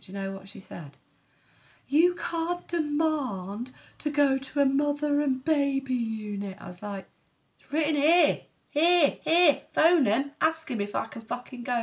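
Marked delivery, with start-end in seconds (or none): 1.9-6.6 slow, higher and louder mocking of a bossy midwife; stern midwife. Midwife refusing the mother and baby unit, slow officious put-on voice. 7.6-11.8 much higher, much louder furious demands; angry shout. Retold or imitated. Arguing with the midwife over the unit, a furious high shout.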